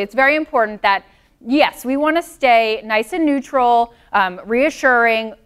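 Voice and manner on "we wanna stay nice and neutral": usual speaking voice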